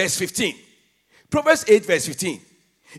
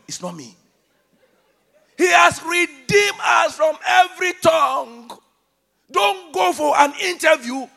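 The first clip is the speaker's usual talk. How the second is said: loud, raised-pitch exhortation; forceful preaching; 7 semitones higher, 6 dB louder than usual. Real, not acted. Preacher challenging those who blame their accent, forceful and loud. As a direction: drive each challenge home to the crowd.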